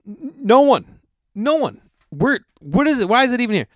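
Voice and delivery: high-pitched disbelief; high incredulous outburst; incredulous rant. Real, not acted. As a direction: squeal it out in disbelief. Ranting about partridge recipe.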